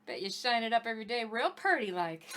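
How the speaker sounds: hint of a Southern country accent, flattering. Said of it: "totally digging and rolling with the Southern accent".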